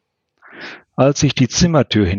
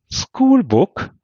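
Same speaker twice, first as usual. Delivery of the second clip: high, startled question; as if she can't believe her eyes; Schoolbook? squeaked up in surprise; surprised; 11 semitones higher than usual. A narrator's reading. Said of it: "wunderte sich Karen".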